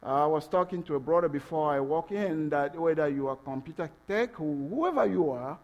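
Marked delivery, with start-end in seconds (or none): none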